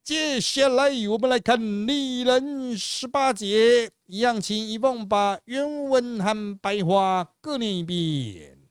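high, slow, drawn-out announcer call; hammed-up high-pitched presenter voice. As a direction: announce the next segment with stretched, ringing words. Host announces next segment.

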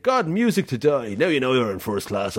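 lightly voiced Irish lad; Irish accent. Retelling the Irish lad's sinking line, light Irish accent. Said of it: "And he's like".

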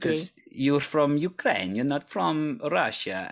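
high, slow mock Russian accent; imitation Russian accent. Shown as a demonstration. Host tries his guest's accent back at her, Russian accent. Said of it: "my attempt of, uh, a similar accent".

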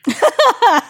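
high loud laugh bursting out; burst of laughter. Real, not acted. Cohost laughing at the warden's reply, loud high laugh. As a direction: crack up loudly.

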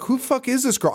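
high, puzzled outburst; hammed-up confusion; mock bafflement. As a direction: ask it high in disbelief. His own baffled reaction retold.

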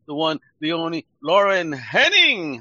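slow, loud showman build-up to the name; announcer intro. Acted. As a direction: announce her like a stage emcee. Host announcing guest like emcee.

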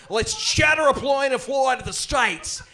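loud, slow, high Australian twang; over-the-top Australian reset phrase; Australian accent. Shown as a demonstration. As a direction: stretch it out in a broad Aussie accent. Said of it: "I'll just say".

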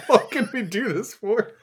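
words pushed out through laughter; laughing. Real, not acted. Amused by the back-and-forth, laughing through the words. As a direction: laugh while asking.

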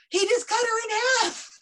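high, frantic mock call for help; mock panic. Audience member calling emergency services, mock panic.

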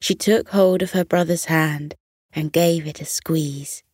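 ordinary delivery

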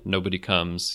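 ordinary delivery

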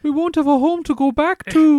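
high, loud hammed-up imitation of her odd Swedish-English line; mock Noomi Rapace accent. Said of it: "the odd line where you're like".

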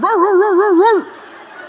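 loud, high run of barks; dog barking. Retold or imitated. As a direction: bark wildly to chase the dog off. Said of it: "barking at him".

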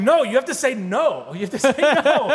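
high, laughing mock exasperation; laughing protest. To strangers who all say yes, high laughing exasperation. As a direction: beg them to say no through laughter. Pleading with eager strangers on camera.

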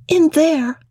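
put-on high, light child voice; small girl pitched high; little French girl Adele. A narrator's reading. Adele points to the room.

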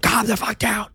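high, forceful whisper-shout; urgent strained whisper-yell. Shown as a demonstration. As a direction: shout it under your breath. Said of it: "I always call it whisper shouts".

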